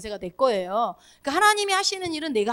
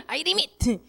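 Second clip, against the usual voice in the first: I limit said like ai rimit; playful English command I limit; short light English declaration; Korean-accented English; 4 semitones higher, much slower than usual. Shown as a demonstration. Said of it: "limit 이렇게 해도 돼요".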